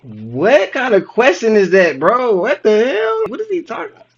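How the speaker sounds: exasperated high-voiced bewilderment; incredulous outburst. Real, not acted. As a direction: react totally baffled, pitch rising as you go. Baffled reaction to the question.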